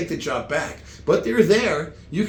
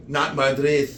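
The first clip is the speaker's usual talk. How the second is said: ordinary talk, nothing marked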